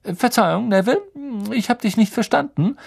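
cheerful Lupin. A narrator's reading. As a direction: brightly ask the pupil to repeat. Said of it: "sagte Professor Lupin gut gelaunt".

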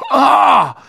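high, loud excited cry. A narrator's reading. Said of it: "sagte Ron aufgeregt".

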